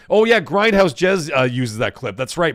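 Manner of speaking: ordinary delivery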